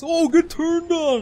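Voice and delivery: high, loud, stretched-out taunt; imitated yell. Someone taunting the player, imitated drawn-out yell. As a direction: holler it slowly in mimicry. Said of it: "somebody told me that once".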